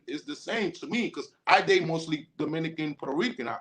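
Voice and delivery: ordinary delivery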